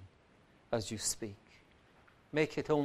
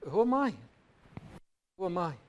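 Paul's lament; 7 semitones higher than usual. Acted. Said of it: "says Paul".

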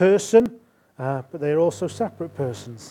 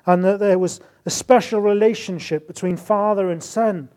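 fervent preaching; 4 semitones higher, 7 dB louder than usual. Acted. Preacher builds on Jesus as Son.

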